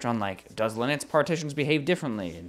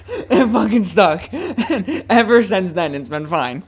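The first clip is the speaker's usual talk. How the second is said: laughing; 8 semitones higher, 7 dB louder, slower than usual. Real, not acted. Laughing at his own screwdriver fix.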